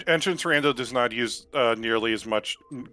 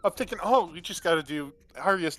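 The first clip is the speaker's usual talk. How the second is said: high, loud oh God panic; panicked realization; 9 semitones higher, 8 dB louder than usual. Real, not acted. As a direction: blurt it in sudden alarm. Realizes more dungeons remain.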